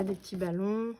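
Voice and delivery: normal talking voice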